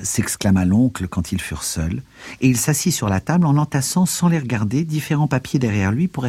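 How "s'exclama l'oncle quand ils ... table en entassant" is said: ordinary delivery, nothing marked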